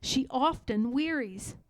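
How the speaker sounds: ordinary delivery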